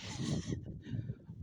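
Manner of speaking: high laugh after a rant. Real, not acted. Speaker breaking off his own rant, laughing.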